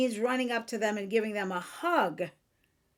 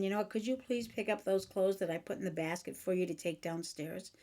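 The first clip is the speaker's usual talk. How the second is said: softer, lower, quick everyday ask; calm request; 4 semitones lower, 8 dB quieter, faster than usual. Acted. Wife repeating a request about laundry, calm and soft. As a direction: ask calmly and quietly, without tone. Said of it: "You can say no calmly".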